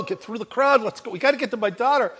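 usual speaking voice

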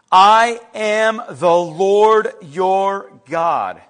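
very slow, weighty proclamation; slow, deliberate repeat. Acted. Preacher repeats God's words to congregation, slow and deliberate. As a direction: let each word stand on its own.

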